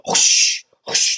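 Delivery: breathy whoosh of a bag squeezed too hard; mock the students over-squeezing the bag; whooshing bagging noise. Instructor mocking students bagging a mannequin, whooshing noise. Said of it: "they're like".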